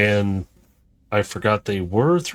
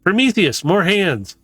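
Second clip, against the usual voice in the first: high, raised call to the ship; bright higher shout of news; 7 semitones higher than usual. Acted. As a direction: sing out the news upward. Ash calls to the ship.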